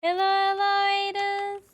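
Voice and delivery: high, loud bubbly greeting; cute anime streamer. Acted. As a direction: greet the raiders like an excited idol. Greeting incoming raiders on stream.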